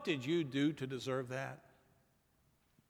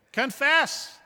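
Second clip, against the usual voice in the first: loud, high call to confess; loud command; 10 semitones higher, 9 dB louder than usual. Acted. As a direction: proclaim it like a summons.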